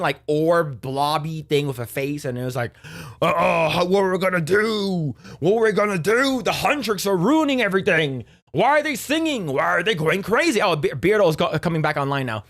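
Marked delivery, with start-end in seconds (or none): 3.2-10.6 high, panicky demon rant; hammed-up villain losing it; mock panic. Voicing the panicking demon boss.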